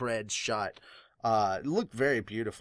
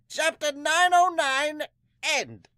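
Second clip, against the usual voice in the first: much higher, slow announcement; announcer voice; 5 dB louder, slower than usual. Acted. Fan closing the chapter in a slow, much higher announcer voice. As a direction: call it like an announcer.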